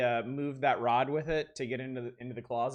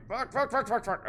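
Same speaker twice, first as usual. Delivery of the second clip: rapid, higher panicked cursing; frantic under pressure; panicked swearing; 8 semitones higher than usual. Real, not acted. Player fleeing the killer, panicked. Cornered in game.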